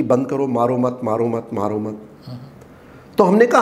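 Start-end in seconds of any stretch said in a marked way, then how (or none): 0.0-2.0 mock begging, low and soft; retold pleading. Guest voices Pakistan begging India to stop, low mock plea. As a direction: imitate a beaten enemy pleading, voice lowered. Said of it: "वो हाथ जोड़ने लग गया कि".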